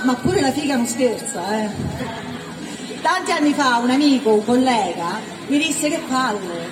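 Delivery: ordinary delivery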